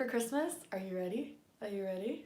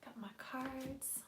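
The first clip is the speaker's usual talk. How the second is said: whisper; 3 semitones higher, 12 dB quieter, slower than usual. Real, not acted. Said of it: "I gotta be kinda quiet".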